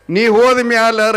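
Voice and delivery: keen the line like a mourner; loud sing-song lament; demo lament. Shown as a demonstration. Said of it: "ಅಂದ್ರಿ ಅಂದ್ರೆ".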